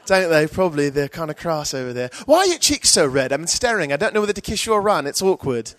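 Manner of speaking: high-pitched British comic, easing down awkwardly; British accent. Retold or imitated. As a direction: put on a polite, chummy British accent. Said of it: "these British comics are really nice".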